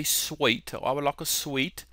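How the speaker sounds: soft put-on British accent. Shown as a demonstration. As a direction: ask quietly as a Brit. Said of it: "give you my British accent".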